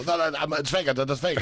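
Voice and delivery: high-pitched, hurried backpedalling; hammed-up alarm, voice jumping up to reassure them; mock panicked reassurance. Acting out comic reassuring alarmed crowd.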